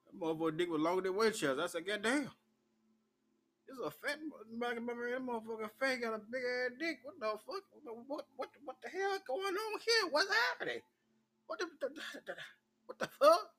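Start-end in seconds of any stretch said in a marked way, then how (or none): 1.8-13.5 shocked reaction. Retold or imitated. Shocked reaction to seeing him.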